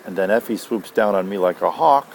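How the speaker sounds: ordinary delivery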